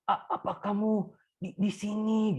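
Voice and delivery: put-on a frail old man straining to ask; dying father, faltering on each word; weak dying voice. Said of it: "Dia lemah banget".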